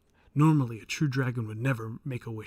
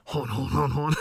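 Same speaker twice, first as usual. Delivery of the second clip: mock French laugh; 6 dB louder than usual. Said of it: "I'm sorry, I don't speak".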